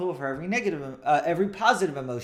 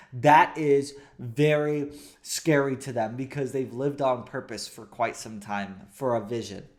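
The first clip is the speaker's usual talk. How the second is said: usual speaking voice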